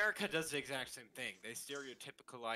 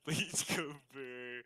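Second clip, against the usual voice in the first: very slow, stretched-out mock quote; slow, silly YouTuber impression; drawn-out quoted catchphrase; much slower than usual. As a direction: drag every syllable out like a catchphrase. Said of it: "what YouTuber did I just quote".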